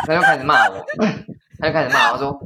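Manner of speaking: real laugh breaking through the retelling. Real, not acted. Telling the listener how the old man scolded her, laughing. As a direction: laugh it off, a bit exasperated.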